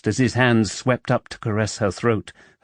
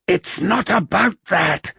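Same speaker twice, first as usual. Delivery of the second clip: hissed; 9 semitones higher than usual. A narrator's reading. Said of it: "he hissed".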